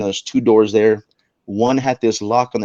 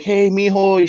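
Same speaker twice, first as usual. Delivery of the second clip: higher, sweet motherly greeting; mom's sweet greeting; 9 semitones higher, slower than usual. Retold or imitated. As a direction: sing it out like a cheerful mom. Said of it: "she's like".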